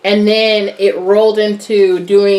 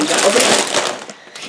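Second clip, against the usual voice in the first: whisper. Shown as a demonstration. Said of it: "A S M R".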